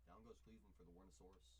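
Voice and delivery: ordinary talk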